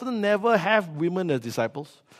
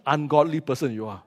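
ordinary talk

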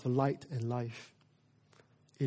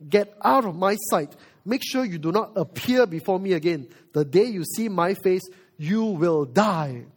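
angry Pharaoh; 3 semitones higher, 6 dB louder than usual. Acted. Pharaoh banishing Moses from his sight, angry. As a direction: banish him in a loud, angry voice. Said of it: "So he's angry".

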